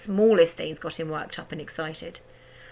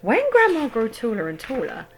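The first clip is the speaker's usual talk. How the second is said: ordinary delivery, nothing marked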